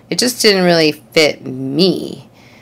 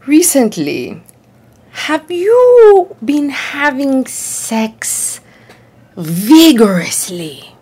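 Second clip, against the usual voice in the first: higher, very slow Australian doctor drawing out "vigorously"; solemn old Australian doctor, savouring each word; Australian doctor asking gravely and slowly; 6 semitones higher, slower than usual. Retold or imitated. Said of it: "this really great accent".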